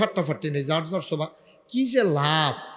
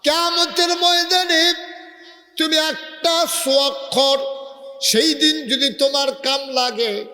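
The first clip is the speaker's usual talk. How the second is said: much higher, slow, sung-out sermon; preaching climax; 9 semitones higher, 3 dB louder, slower than usual. Acted. Preacher chants to the crowd about judgment day, high and slow. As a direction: cry it out high and slow at the peak.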